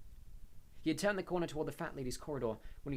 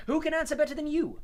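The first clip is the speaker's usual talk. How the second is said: exasperated; 13 dB louder than usual. A narrator's reading. Harry continuing exasperated.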